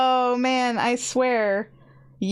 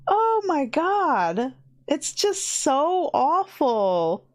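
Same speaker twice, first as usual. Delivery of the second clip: high, drawn-out exasperation; exasperated dismay; 6 semitones higher, much slower than usual. Real, not acted. Streamer fed up with YouTube censorship, drawn-out exasperation. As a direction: stretch the words out in pitched-up dismay.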